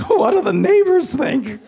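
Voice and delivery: high, loud, drawn-out comic dismay; mock horror. Pastor fretting about neighbors during police raid, mock horror. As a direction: wail it slowly in exaggerated embarrassment. His mock-horrified worry.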